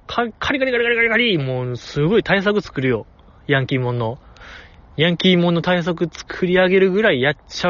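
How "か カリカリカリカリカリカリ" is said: fast, higher scratchy pen noise; pen scratching noise. Retold or imitated. Host imitating Miyazaki drawing furiously, fast scratching sound. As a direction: mimic a pen racing over paper. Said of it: "またもう一回ペン取るよ".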